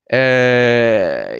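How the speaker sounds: ordinary talk, nothing marked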